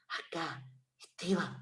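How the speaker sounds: quiet, hushed, dropping the voice right down; child dropping his voice to speak to the therapist. Retold or imitated. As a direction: say it softly like a shy child. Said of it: "como baja como, como el tono de voz".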